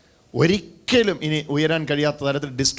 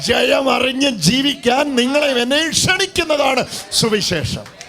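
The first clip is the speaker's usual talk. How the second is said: higher, louder climax of the sermon; fervent shout; 6 semitones higher, 3 dB louder, slower than usual. Real, not acted. Pastor proclaiming the gospel, fervent shout. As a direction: proclaim it with all your force.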